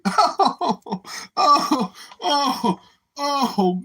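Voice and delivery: high breathless oh's through laughter; gasping between laughs; laughing. Real, not acted. Laughing fit at chat.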